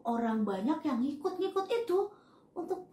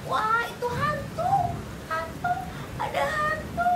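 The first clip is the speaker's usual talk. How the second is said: much higher, terrified cry of "hantu"; frightened scream; 13 semitones higher than usual. Acted. Disciples screaming in fear at a ghost on the water, panicked cry. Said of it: "mereka berteriak".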